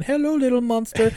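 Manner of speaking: high, far-off creepy voice; creepy greeting. Retold or imitated. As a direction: coo it like a creep. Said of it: "him going".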